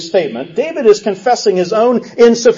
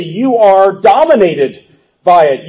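ordinary talk, nothing marked

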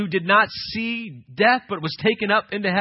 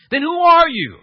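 raised and higher, pressing for an answer; an envoy demanding John's identity, loud and pointed; 9 semitones higher, 10 dB louder than usual. Retold or imitated. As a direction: push him hard for who he is. Priests press John for answer.

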